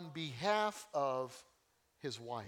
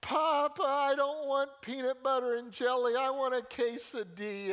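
high, loud demanding whine; loud high toddler complaint; whiny toddler; 8 semitones higher, 6 dB louder than usual. Retold or imitated. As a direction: whine for a quesadilla like a spoiled child. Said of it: "take whining to an art form".

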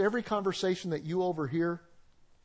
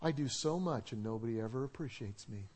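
softer, self-pitying mock sigh of a martyr; martyr complaint; 6 semitones lower, 8 dB quieter than usual. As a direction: play the unappreciated martyr. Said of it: "Some people are martyrs".

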